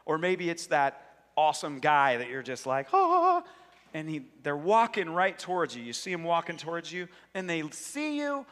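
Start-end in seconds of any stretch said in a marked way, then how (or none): 2.9-3.4 high, loud dreamy "ah"; swooning cry. Acted. Admirer seeing that awesome guy, swooning cry. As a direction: sigh out loud, high and smitten.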